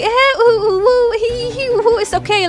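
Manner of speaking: high, hammed-up whimpering coos; mock whimpering. Streamer mocking how she won't meekly whimper it off, high mock whimper. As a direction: parody someone meekly swallowing the hurt. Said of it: "I'm not gonna sit here and just act like".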